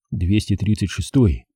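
ordinary delivery, nothing marked

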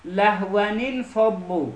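"لهونین فبو" slowly as lahwanin-fadhu; slow, linked model reading of "لهونین فبو"; nun given kasra. Shown as a demonstration. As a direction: draw out "لهونین" with an i after the first n.

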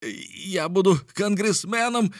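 high, drawn-out jubilant yell. A narrator's reading. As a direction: wail it proudly, very high and slow. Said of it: "вопил". (ликующий вопль)

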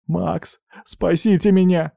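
high, plaintive begging for help; pleading. Acted. Man stuck on the mast to his friend, pleading. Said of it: "взмолился Горинц".

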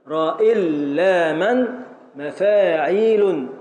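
"راء إلا من مفاعيل" in long, measured syllables; match "راء إلا من" to "مفاعيل" beat for beat; slow metrical scansion. Shown as a demonstration. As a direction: chant "راء إلا من مفاعيل" with stretched vowels. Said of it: "ونقول".

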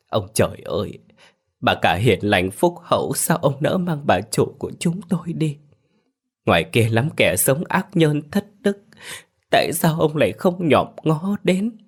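a servant sobbing and blaming heaven for his mistress's death; sobbing reproach. A narrator's reading. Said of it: "nức nghẹn ... trách móc". (nức nở trách trời, giọng vút cao)

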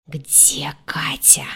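slow, hissing angry whisper. A narrator's reading. Captain demands to know where Katya is, angry whisper. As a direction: whisper it with menace, drawn out. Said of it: "выдал свистящим злым шёпотом".

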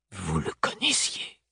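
hushed, slow, low question; tense, restrained undertone; stiff whisper. A narrator's reading. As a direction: ask it very softly and rigidly. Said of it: "chuchoté ces mots avec une raideur extrême".

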